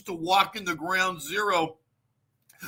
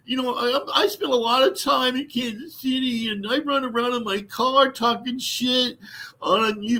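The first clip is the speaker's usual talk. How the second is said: high, whiny begging mock voice, pitched up; groveling wannabe co-host; 6 semitones higher than usual. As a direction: beg like a desperate fanboy near tears. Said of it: "you're like".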